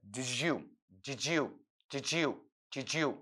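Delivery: "डिजू" softly, d and y merged into a j sound; blended diju. Shown as a demonstration. Teacher repeating the connected-speech sound. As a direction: repeat "डिजू" quietly as one word. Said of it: "ऐसा, ऐसा sound निकलेगा".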